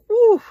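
high, loud whooping whew; excited whew. Real, not acted. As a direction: let out a thrilled, piercing whew. Overwhelmed by the gold logo.

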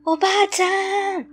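high, loud searching shout; shouting for grandma. Acted. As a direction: call out into the empty riverbank. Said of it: "と叫んだけど". (祖母を探して叫ぶ)

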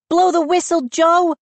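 put-on high, urgent girl's cry; Mindy crying out. A narrator's reading. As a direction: urge Joe on. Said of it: "Mindy cried".

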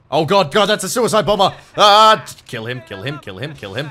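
frantic shouts at the squad; panicked yelling. Real, not acted. Player screaming at his squad to kill the bomber, panicked. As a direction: yell in real panic as the bomber charges.

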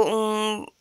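usual speaking voice

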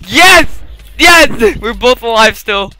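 high, loud triumphant yells; excited cheer. Real, not acted. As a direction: cheer the win. Victory cheer in game.

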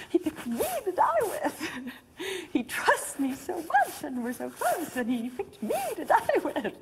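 high, laughing, thrilled retelling; high and giddy, breaking into laughs; very excited. Retold or imitated. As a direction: act out giddy, flattered excitement. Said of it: "then I got very, very excited".

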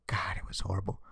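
breathy disgusted whisper; hushed "God, it was horrible". Real, not acted. Disgusted aside about comic.

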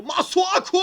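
high, loud call of the name; excited yell. Real, not acted. Calling a chance, sudden shout.

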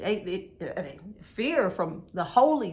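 normal talking voice

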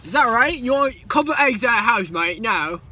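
loud, high mock Cockney; British accent. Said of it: "I sound like the guys from Kingsman".

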